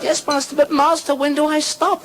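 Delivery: very high, urgent squeal; frantic pupil. Acted. Grasshopper begging his master for relief, high frantic plea. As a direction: plead at fever pitch.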